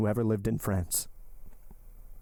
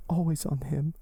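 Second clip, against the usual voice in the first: much higher, pleading heroine voice; desperate young woman; 6 semitones higher than usual. Acted. Heroine entreating her lover to live, high and pleading. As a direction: lift the voice and entreat him.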